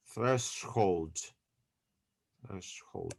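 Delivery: "threshold" said carefully with "sh" before "hold"; pronunciation demo. Shown as a demonstration. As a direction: show how to say "threshold": THRESH-hold, softly. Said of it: "this like that".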